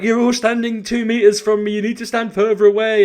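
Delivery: shrill-free hammed-up rant at the staff. Rude customer ranting at shop staff, mock high and loud. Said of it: "being really difficult and ranting".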